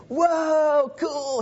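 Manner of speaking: high, loud, goofy "Whoa, cool"; "Whoa, cool" shouted high like a dazzled teen; mock surfer whoa. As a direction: toss off "Whoa" like an impressed surfer. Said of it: "He didn't say".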